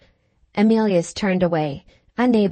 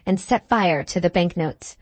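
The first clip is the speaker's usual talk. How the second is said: ordinary delivery, nothing marked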